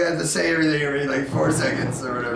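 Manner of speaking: fast mocking quote. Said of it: "he's like".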